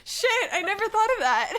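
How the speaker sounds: laughing it off. Real, not acted. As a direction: blurt it out while cracking up.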